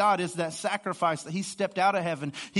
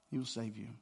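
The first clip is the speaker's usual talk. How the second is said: softer, hushed repeat; 8 semitones lower, 14 dB quieter, faster than usual. Real, not acted. Pastor softly repeating the promise to listeners, hushed. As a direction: let the promise sink in softly.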